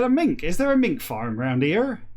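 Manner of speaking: West Country farmer, leaping high then easing down. Retold or imitated. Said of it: "Like a farmer".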